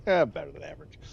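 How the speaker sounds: replaying his own quip with amused swagger; cocky replay. Shown as a demonstration. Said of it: "Like, yeah, that, that was my response".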